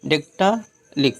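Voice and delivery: "डेक्टालिक" slowly, long "dektaa", pause, short "lik"; syllable-by-syllable. Shown as a demonstration. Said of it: "ली बोलिए फिर का बोलिए".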